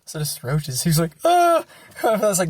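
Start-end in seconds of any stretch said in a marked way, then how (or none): 1.2-1.6 high "ah" of alarm; mock frightened squeal. Friend reacting to roaches, mock frightened squeal.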